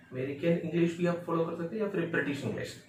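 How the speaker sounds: normal talking voice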